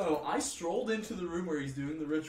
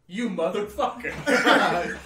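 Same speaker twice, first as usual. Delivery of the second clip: slow, louder curse through laughter; laughing curse; 6 semitones higher, 11 dB louder, much slower than usual. Retold or imitated. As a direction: drag out the insult while cracking up. Wizard realizes he was tricked.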